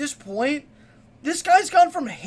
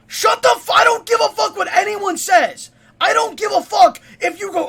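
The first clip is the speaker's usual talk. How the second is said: loud, high-pitched furious rant; angry shouting; 5 semitones higher, 6 dB louder than usual. Real, not acted. Fan yelling at a critic over the songs, angry shouting. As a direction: shout down the critic with full force.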